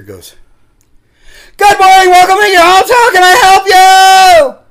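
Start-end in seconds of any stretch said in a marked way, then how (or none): 1.6-4.6 loud shrill drive-thru lady. Retold or imitated. Imitating the drive-thru lady blaring over the speaker, high and loud. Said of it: "That's her voice. That's how loud, loud and shrill".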